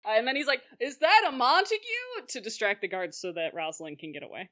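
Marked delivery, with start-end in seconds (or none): 0.8-1.8 loud, higher mock alarm; calling out. Distracting the guards so Rosalind escapes, calling out. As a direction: exclaim it to grab attention.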